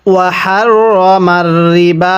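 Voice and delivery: very slow chant of আহাল্লাল্লাহুল বাইআ; tajweed recitation; Quran recitation. Shown as a demonstration. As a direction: draw it out in tajweed style. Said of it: "কিভাবে পড়তে হবে?".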